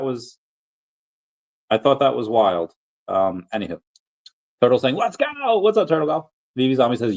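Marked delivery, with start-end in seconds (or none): 5.0-6.3 a much higher, hyped let's go; excited shout of let's go. Retold or imitated. Reading a viewer's hype comment aloud, excited cheer. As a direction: read it like a cheering fan.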